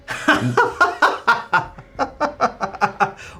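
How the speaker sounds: high, loud mocking laugh; mocking dragon laugh. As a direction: cackle down at the mortal. Dragon mocks the bowing king.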